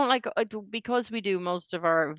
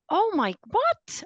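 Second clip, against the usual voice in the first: surprised; slower than usual. Real, not acted. Realising he is American.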